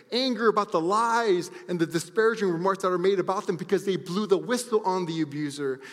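loud, high, building intensity; impassioned preaching. Real, not acted. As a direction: preach it with full fire. Impassioned preaching on victims' pain.

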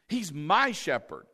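MY leaned on and lengthened; stress on MY. Shown as a demonstration. Preacher saying he may be yours but he's mine, stressing MY. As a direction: claim him with a strong "my". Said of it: "but he's mine".

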